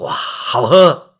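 slow, loud, relishing wow; savoring wow. Acted. Hercules tasting the centaur wine, slow relishing wow. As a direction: let the taste sink in, drawn out.

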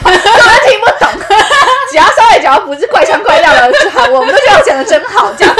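laughing. Real, not acted. Laughing about judging Busan dialect.

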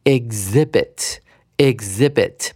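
exhibit slowly, ig-ZI-bit, silent h; stress on second syllable. Shown as a demonstration. Teacher models exhibit twice for students, slow second-syllable stress. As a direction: hit the i in exhibit, slow and careful. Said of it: "重音在第二音节的I上面".

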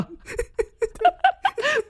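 long high laugh falling off; high shrieking laugh. Real, not acted. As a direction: let the laugh run out. Recalls teen's serious question.

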